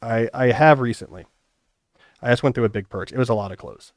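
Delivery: normal talking voice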